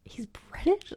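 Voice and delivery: high rising whispered question; imitating her own shock; shocked disbelief. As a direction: squeak it out in disbelief. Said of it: "I looked him up and I literally went".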